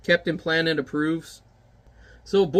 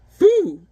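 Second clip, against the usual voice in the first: high, loud blowing foo; puff of breath; 13 semitones higher, 9 dB louder than usual. Acted. Giant blowing smoke at the moon, loud foo. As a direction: blast air out hard. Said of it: "That better not get in my paws".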